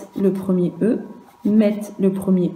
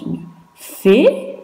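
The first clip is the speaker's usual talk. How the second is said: «Une fée» said higher, rising on «fée»; «fée» climbs in pitch; rising tune; 5 semitones higher than usual. Shown as a demonstration. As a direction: lift «fée» up. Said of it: "Écoute bien la différence".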